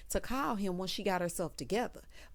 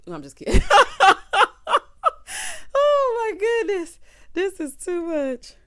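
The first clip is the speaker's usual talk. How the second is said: high, loud laughter through words; laughing hard; 11 semitones higher, 11 dB louder than usual. Real, not acted. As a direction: crack up at your own joke. Host laughs hard at own joke.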